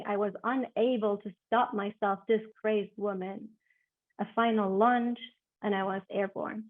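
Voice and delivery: expressive literary reading, swelling to loud climaxes; dramatic reading. Acted. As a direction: read the passage with rising drama. Author reads her novel excerpt.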